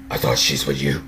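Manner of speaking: low, soft, menacing Batman growl; intimidating Batman voice. Retold or imitated. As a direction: mimic the brooding hero's threatening tone. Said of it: "very intimidating voice".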